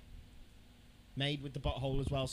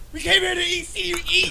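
mock complaint; 12 semitones higher, 10 dB louder than usual. Real, not acted. Mocking the slow whispering video.

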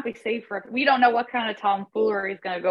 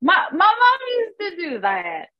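mock tearful; 8 semitones higher, slower than usual. Mock nostalgia over Capri Sun.

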